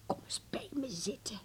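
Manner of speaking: a hushed whisper asking him to come sit; whispered invitation. Acted. Grandmother beckoning Harm to sit, whispered.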